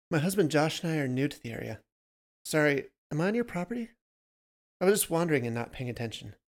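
a touch of flustered apology; lightly voiced polite newcomer. A narrator's reading. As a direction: explain yourself in a hurry, lightly acted. Voices Emily apologizing to the stranger.

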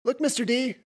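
high, loud interjection; chiming in brightly. A narrator's reading. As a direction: pipe up to get the teacher's attention. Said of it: "Sam chimed in".

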